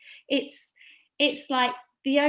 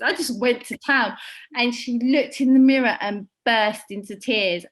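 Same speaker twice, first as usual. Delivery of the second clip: ordinary delivery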